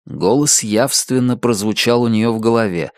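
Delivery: ordinary delivery, nothing marked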